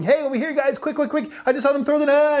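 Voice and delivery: high, hurried excited little fish voice; smart fish voice. Pretend. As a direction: squeak out a warning like a scurrying fish. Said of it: "smart fish on the other side going".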